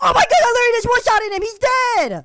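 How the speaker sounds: fast, loud, high gleeful bragging; a kid crowing over a one-shot kill, fast and loud; excited boasting. Acted. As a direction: rattle it off thrilled at the win. Kid boasting after big hit.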